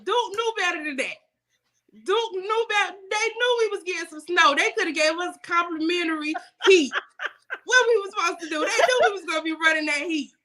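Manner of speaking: mock outrage, laughing. Real, not acted. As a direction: grumble at the company through laughs. Laughing complaint about power company.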